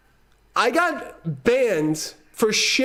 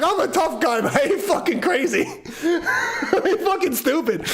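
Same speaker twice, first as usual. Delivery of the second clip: laughing through the words, pitch climbing; cracking up at yourself; breaking into laughter mid-brag; 7 semitones higher than usual. Real, not acted. Laughing boast about the ride.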